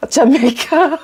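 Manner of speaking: lightly voiced happy, proud older man; proud older guy. Older guy in an elevator guessing where they're from, lightly imitated pride. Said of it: "looks so happy and proud of himself".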